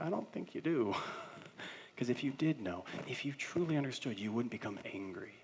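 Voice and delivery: acting out a quiet, patient reply; gentle counselor. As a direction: answer anger softly, as a counselor would. Retelling counselor's gentle reply to anger.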